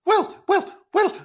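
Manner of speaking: high, brightening character voice; lightly hammed-up relief; cheering up. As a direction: perk up with each word. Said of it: "said Flange, cheering up".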